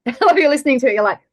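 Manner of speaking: ordinary delivery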